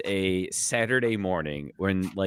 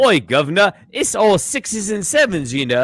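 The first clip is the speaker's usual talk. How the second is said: higher, hammed-up British accent; 7 semitones higher, 6 dB louder than usual. Shown as a demonstration. Drew finally attempts it on the spot, British accent. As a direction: put on a cheeky British accent, raised in pitch. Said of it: "I can do a British accent".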